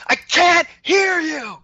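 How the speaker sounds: slow, high, loud shout; mock not hearing. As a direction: stretch it out, hollering in feigned deafness. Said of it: "instead of yelling back, I said".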